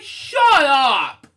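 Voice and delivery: loud, high, fed-up retort; yelled shut up. Retold or imitated. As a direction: snap it back at the teasing dentist. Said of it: "I'm like".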